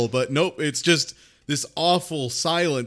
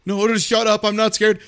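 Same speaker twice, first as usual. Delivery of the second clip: raised, higher mock-defensive retort; mock angry denial; 6 semitones higher, 5 dB louder than usual. Imitating dad denying fear to daughter, mock angry. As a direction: imitate a dad snapping back.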